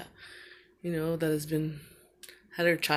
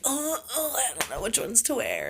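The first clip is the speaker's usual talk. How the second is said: put-on whiny little boy; 6 semitones higher than usual. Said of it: "You used to always be like".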